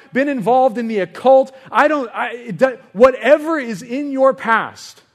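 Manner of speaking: high, loud, emphatic appeal; forceful preaching. Real, not acted. Preacher telling church their past doesn't matter, impassioned loud appeal. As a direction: drive each phrase home to the congregation.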